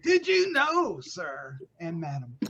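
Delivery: high, loud showman build-up; mock showman tease. Host teasing co-hosts with a mock showman's build-up. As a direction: play the carnival barker teasing the news.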